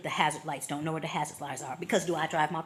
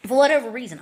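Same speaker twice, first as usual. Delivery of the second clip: louder, higher burst of frustration; excited outburst; 6 semitones higher, 10 dB louder, faster than usual. Real, not acted. Telling friends about the stalled car, exasperated loud burst.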